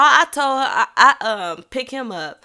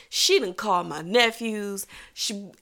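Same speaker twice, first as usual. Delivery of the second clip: usual speaking voice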